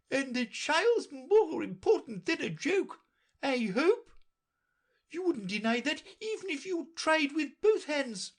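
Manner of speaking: very high, loud impatient lecture; impatient Red Queen. A narrator's reading. Red Queen impatiently lecturing Alice, high and loud. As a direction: scold her impatiently. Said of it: "interrupted her impatiently".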